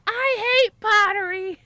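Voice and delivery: loud, high-pitched outburst; screamed out. Retold or imitated. Potter venting while someone ahead is out of earshot, screamed out. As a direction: scream it out in exasperation. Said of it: "I just screamed out".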